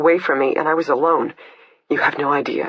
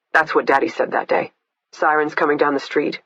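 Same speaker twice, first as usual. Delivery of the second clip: usual speaking voice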